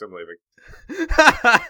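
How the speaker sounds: high, drawn-out huffy exit; mock exit. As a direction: storm off in slow mock offense. Mock quitting the podcast.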